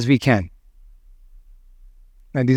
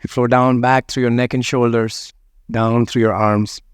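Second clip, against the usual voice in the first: guided meditation; 3 semitones lower than usual. Acted. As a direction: let each phrase settle before the next. Guides a body scan.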